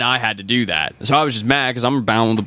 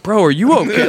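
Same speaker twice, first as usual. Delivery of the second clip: worried friend; 10 semitones higher, 5 dB louder than usual. Retold or imitated. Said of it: "And they're like".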